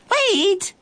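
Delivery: high-pitched calling cry. A narrator's reading. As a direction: cry out after someone running away. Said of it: "the girl cried".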